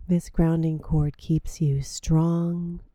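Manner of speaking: ordinary talk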